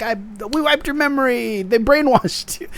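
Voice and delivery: high, overwrought mock revelation, slowing at the end; mock melodrama. Podcaster imitating the sisters' future fight, high mock melodrama. Mocks imagined clash of the sisters.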